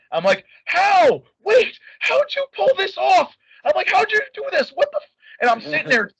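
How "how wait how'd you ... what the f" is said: stunned amazement. Retold or imitated. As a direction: gasp it out in pure disbelief. Stunned at the birthday surprise.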